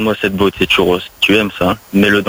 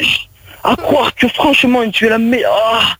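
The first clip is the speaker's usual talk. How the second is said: hammed-up climax. Improvised sex scene, mock climax.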